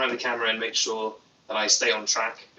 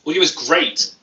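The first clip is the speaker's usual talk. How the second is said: louder, pleased read; 4 semitones higher, 6 dB louder than usual. Real, not acted. Vlogger happily reads a viewer saying audio is great. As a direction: cheer the comment a bit louder. Cheering a chat comment.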